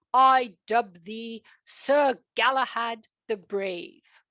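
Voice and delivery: king knighting the kitten; solemn dubbing. Acted. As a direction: knight him with ceremony. Mock knighting ceremony.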